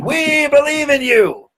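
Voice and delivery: high, slow cheering crowd chant. Retold or imitated. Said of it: "crowd answers".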